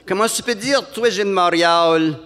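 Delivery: high, indignant Montréal lady. Retold or imitated. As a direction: squeak it out, offended.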